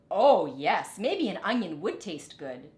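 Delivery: a farmer keen on the idea, "Oh, yes" burst out loud; bursting "Oh, yes" that settles into a suggestion; eager farmer. A narrator's reading. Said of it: "said a farmer".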